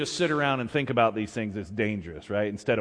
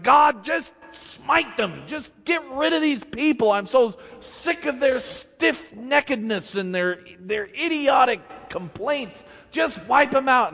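exasperated Moses; 8 semitones higher than usual. Retold or imitated. Moses begging God to wipe out the people, exasperated. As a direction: vent like a leader sick of complainers. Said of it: "Moses would be like".

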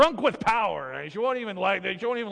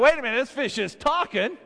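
high, loud astonished cry; freaking out; 10 semitones higher, 5 dB louder than usual. Acted. Fisherman startled by a talking fish, high, loud freak-out. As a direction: act startled out of your wits. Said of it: "the guy freaks out".

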